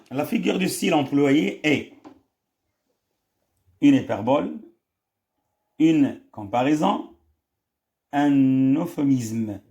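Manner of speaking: slow, drawn-out reading of the options; reading the question. Shown as a demonstration. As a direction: spell out each choice slowly for the class. Reads exam question slowly. (قراءة بطيئة للسؤال)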